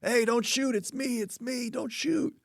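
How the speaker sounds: high, strained plea not to shoot; panicked hunter. Retold or imitated. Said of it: "He goes".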